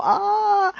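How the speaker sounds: high mock cry of despair; a short hammed-up wail. (lamento exagerado de burla)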